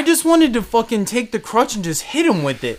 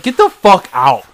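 louder, slow, each word pushed out; slow angry order; 3 semitones lower, 6 dB louder, slower than usual. Retold or imitated. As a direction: drive him out of the store. Ordering customer out.

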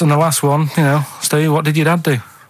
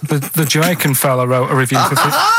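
laughing through the words. Real, not acted. Breaks up reading clue.

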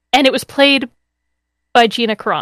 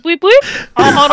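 New Zealand beeping droid; 5 semitones higher than usual. Pretend. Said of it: "beeped in a New Zealand accent".

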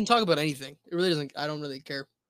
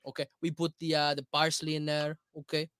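very Italian American accent. Shown as a demonstration. Food show host introducing a recipe, mock Italian accent. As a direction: play up the Italian accent on every word. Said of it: "you're an Italian American, very Italian American".

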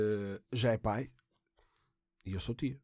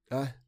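choking cough. Real, not acted. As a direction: splutter and cough, then laugh it off. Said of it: "Estou-me a engasgar".

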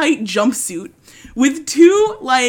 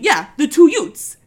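a touch of a Brooklyn lawyer, louder and higher; a Queens accent on "the two Utes"; New York accent; 6 semitones higher, 6 dB louder than usual. Retold or imitated. Vinny keeps telling the judge, mock New York accent. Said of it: "Queens accent".

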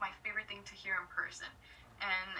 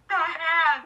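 louder, higher squeal of the line; excited exclamation; 5 semitones higher, 15 dB louder than usual. Retold or imitated. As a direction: burst out in excitement. Friend exclaiming about the hand.

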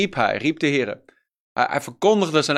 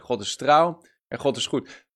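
usual speaking voice

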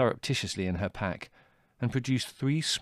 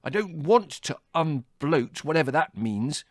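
icy; 7 semitones higher, 4 dB louder than usual. A narrator's reading. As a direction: draw it out with icy disdain. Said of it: "he said icily".

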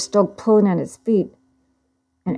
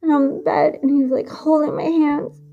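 higher, shaky with tears, on the edge of crying; frightened, tearful retelling; 7 semitones higher than usual. Acted. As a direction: push through the fear as you relive it. Retelling a violent assault, distressed.

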